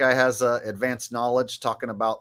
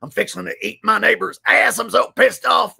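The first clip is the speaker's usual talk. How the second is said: high, loud, ranting rage; classic Alex Jones; 7 semitones higher, 6 dB louder than usual. Retold or imitated. Streamer shows off his old Alex Jones impression, high loud ranting. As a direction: bellow it like a furious conspiracy radio host. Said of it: "classic Alex Jones".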